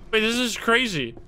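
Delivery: ordinary talk, nothing marked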